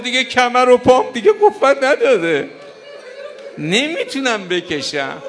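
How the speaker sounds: a broken man who can't lift the body; Hussein's strained, grieving lament. Acted. Hussein voiced, exhausted.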